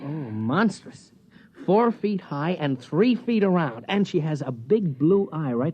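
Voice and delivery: drawn out, mock-serious tease; slow teasing. Husband teasing his jealous wife about the stewardess, slow mock-serious. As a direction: spin the joke out slowly to tease her.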